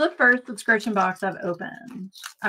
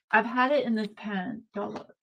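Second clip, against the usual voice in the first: ordinary talk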